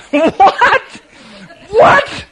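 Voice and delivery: high squeaky incredulous what; baffled what. Retold or imitated. Guide reacting to campers, high incredulous disbelief.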